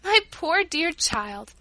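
high, drawn-out affectionate exclamation; fond exclamation. A narrator's reading. Rosa exclaims over the generous child, fondly. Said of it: "exclaimed Rosa".

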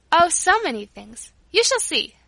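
high, loud and gleeful; excited. A narrator's reading. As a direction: tease with big secret plans. Child excitedly hints at shopping plans.

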